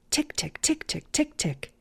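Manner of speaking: soft clicking tick of windshield wipers. Acted. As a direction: tick it out softly like wipers on dry glass. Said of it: "great sound effects".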